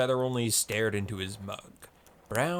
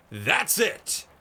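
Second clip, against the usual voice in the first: high, loud triumphant roar; 8 semitones higher, 4 dB louder than usual. Acted. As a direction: bellow it out in triumph. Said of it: "roared Blazefeather".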